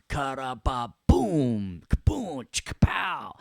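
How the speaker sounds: high, drawn-out comic-book booms and pows; swooping homemade impact noises; cheesy sound effects. Shown as a demonstration. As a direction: voice cartoon explosions with gusto. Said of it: "assign your own little sounds".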